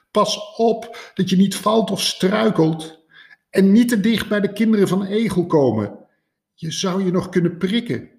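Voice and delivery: high, loud fussing rabbit father; worried calling out. A narrator's reading. Father rabbit calling warnings after his kids, high and anxious. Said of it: "riep Konijn ze na".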